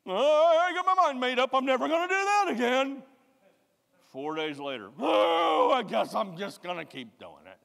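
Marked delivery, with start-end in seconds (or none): 0.0-3.0 high, loud hammed-up vow; loud mock vow, much higher; mock shouted vow. Mock resolve, much louder. 5.0-7.6 hammed-up surrender; mock giving up.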